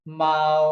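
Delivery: माओ drawn out and level; first tone. Shown as a demonstration. Teacher demonstrating the marked tone, level tone on माओ. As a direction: hold माओ on one steady note. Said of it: "first tone".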